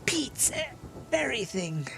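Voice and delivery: very high squeaky echo; excited kobold crier. Acted. Crier proudly echoing the player, very high. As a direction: parrot it proudly.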